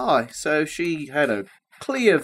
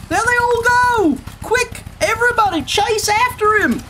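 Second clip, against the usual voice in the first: urgent call. A narrator's reading. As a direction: rouse everyone to chase. Crowd urges chase.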